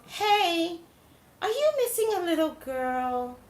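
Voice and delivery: high-pitched retold call-out; drawn-out, high acted question. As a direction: mimic hailing a group of neighbors. Acts out calling to the family.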